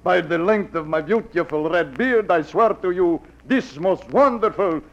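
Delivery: fake Russian accent. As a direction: play a fake Russian peddler at the door. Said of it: "like the accent, the beard".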